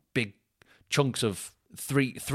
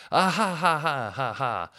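mock laughter; 5 semitones higher, slower than usual. People on FaceTime laughing beside him, imitated laughter. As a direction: imitate carefree laughing, starting high and settling. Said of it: "no wonder they're laughing so much".

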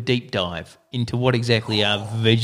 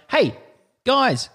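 cheery plug; 10 semitones higher than usual. Acted.

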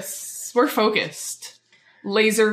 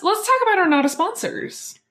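car salesman; 9 semitones higher than usual. Pretend. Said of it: "Why are you talking like a car salesman?".

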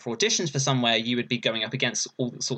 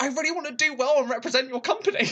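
eager gushing; 12 semitones higher than usual. Retold or imitated. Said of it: "I was like".

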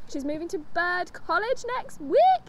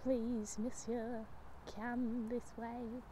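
soft, low, slow Cockney plea; hushed, drawn-out poor Cockney voice; pleading Cockney character; 4 semitones lower, 12 dB quieter, slower than usual. Acted. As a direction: beg quietly in a Cockney accent. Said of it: "like, Cockney when they're lower".

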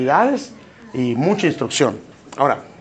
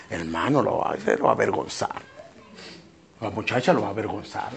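whispered, much softer warning; hushed aside; 6 semitones lower, 8 dB quieter than usual. Real, not acted. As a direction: lower the voice to a whisper. Secreto sobre avergonzar al niño. (susurrando, en voz baja)

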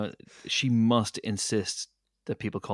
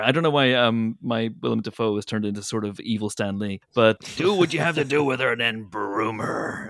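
villain voice; 7 dB louder than usual. Acted. Voicing Goblin-like dad.

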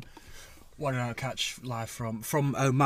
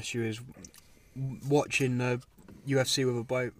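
usual speaking voice

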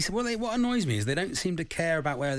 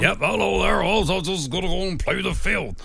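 West Country farmer; 5 semitones higher than usual. Acted. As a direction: play the cheerful West Country farmer in wellies. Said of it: "who's from the West Country".

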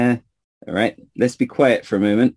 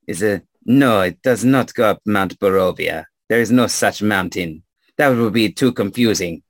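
put-on explaining in a French accent; French-accented local giving directions. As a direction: lay out the route with a French accent. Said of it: "stranger with a French accent".

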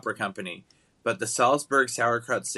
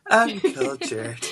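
mock echo; much slower than usual. Real, not acted. Mock-echoing partner's jab.

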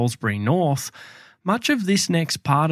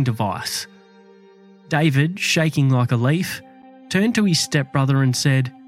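true crime narrator. A narrator's reading. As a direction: tell it like a dark true crime tale.

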